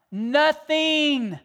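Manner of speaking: "Nothing" held long, high and loud; "Nothing" stretched out, high and loud, as the climax; emphatic Nothing. Real, not acted. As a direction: land "Nothing" like a final verdict. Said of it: "emphatic climax word".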